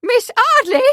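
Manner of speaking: mother shrieking in horror. Acted. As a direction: let the name rise into a shriek. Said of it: "Her voice rose into a shriek".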